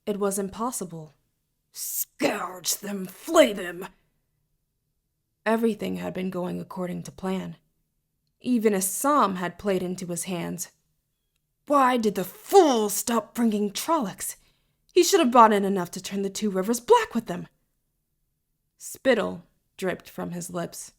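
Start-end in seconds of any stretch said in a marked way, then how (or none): 1.7-3.9 harsh hissed curse bursting out on the last words; venomous rage. A narrator's reading. Villain raging at enemy village. 11.7-17.5 exasperated, with sharp rises on key words; indignant fuming. A narrator's reading. Villain fuming over failed plan.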